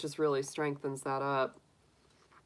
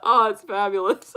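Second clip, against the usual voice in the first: ordinary talk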